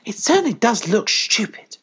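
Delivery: high puppy voice, mid-yawn. A narrator's reading. Puppy looking down at piglet, yawning. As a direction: pipe it high through a light yawn. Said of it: "said one of the puppies, yawning".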